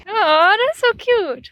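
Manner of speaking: high, loud aww; touched. Real, not acted. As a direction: gush over something sweet. Touched by partner's words.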